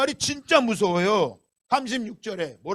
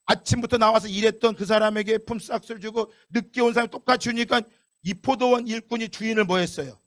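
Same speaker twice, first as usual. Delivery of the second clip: ordinary delivery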